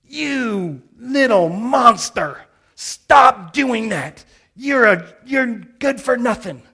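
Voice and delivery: put-on older woman scolding the boy loudly and slowly; angry scolding. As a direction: tell the boy off loudly, dragging the words. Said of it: "and she says".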